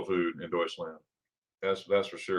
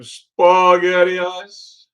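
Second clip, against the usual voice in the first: drawn-out delight; 9 semitones higher, 10 dB louder, much slower than usual. Real, not acted. Savoring a favorite German dessert.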